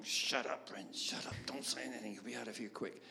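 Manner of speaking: hushed unvoiced whisper to himself; inner voice whisper. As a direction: hiss it as a frantic inner warning. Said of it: "my head was going".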